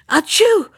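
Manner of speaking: high, loud acted sneeze. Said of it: "sneezed the old man".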